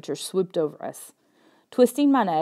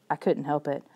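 plain reading voice

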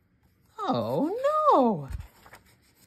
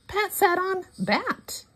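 much higher squeak on the last line; high surprise; 13 semitones higher, 4 dB quieter than usual. Acted. Reader to child, high surprised squeal.

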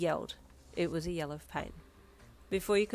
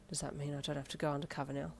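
soft, lowered, hesitant voice; subdued question; 3 semitones lower, 7 dB quieter, faster than usual. A narrator's reading. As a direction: ask it quietly after long silence. Beaten Darby quietly asks police.

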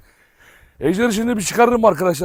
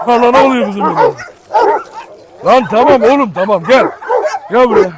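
louder calls to the dog; calling out loud; 4 semitones higher, 6 dB louder than usual. Real, not acted. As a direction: summon the dog over the noise. Calling dogs amid commotion. (köpeği yüksek sesle çağırma)

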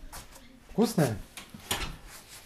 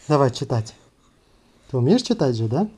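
normal talking voice

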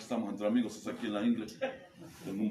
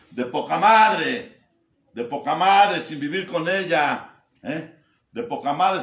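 loud, slow and higher shout; emphatic shout; 7 semitones higher, 13 dB louder, slower than usual. Real, not acted. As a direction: stretch out "De poca madre" in a loud, drawn-out shout. Repite la frase. (grito lento y fuerte: "De poca madre")

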